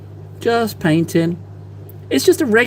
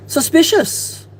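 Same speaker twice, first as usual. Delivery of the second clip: high, drawn-out suspicious tone; playful conspiratorial suspicion; mock suspicious; 12 semitones higher, slower than usual. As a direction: tease the audience with suspicion, slowly.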